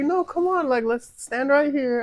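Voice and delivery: put-on high, pushy girlfriend voice. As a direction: whine it up high like her. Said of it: "She's like".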